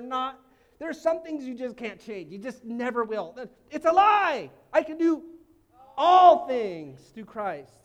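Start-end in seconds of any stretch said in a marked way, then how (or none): 3.7-7.7 slow, much louder proclamation; preaching at full force. Real, not acted. Pastor declaring the verse over the congregation. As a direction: drag out all things for power.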